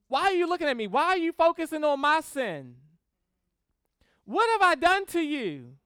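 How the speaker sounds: loud, high aggrieved whine; aggrieved complaint. Acted. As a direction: complain at God like a wronged child. Job voiced complaining to God.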